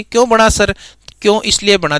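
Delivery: ordinary delivery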